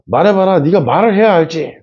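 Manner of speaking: father's mock browbeating; harsh demand. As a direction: bark it like a stern father cornering his kid. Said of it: "막 이렇게 윽박지르죠".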